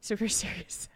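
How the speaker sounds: unvoiced whisper. Real, not acted. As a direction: whisper it to yourself. Whispers the wrong name.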